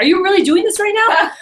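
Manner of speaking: high-pitched, incredulous challenge; exasperated. Retold or imitated. Manager arguing with a rep on the phone, exasperated. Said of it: "He's fighting me on the phone".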